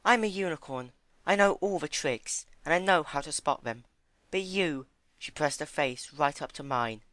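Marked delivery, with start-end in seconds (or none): 0.0-4.9 high, gentle pony voice; high timid pony. Acted. Fluttershy voicing Rarity.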